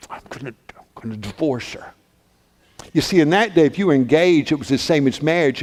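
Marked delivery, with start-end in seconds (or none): 0.1-1.9 halting, low whisper; hushed resolve. Acted. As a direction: whisper a painful decision to yourself. Joseph's hurt private resolve.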